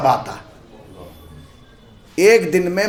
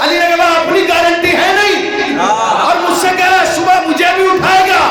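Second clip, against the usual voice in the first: high, loud impassioned sermon cry; shouted majlis oration; preaching at full force; 9 semitones higher, 4 dB louder than usual. Acted. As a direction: rouse the gathering with fervour.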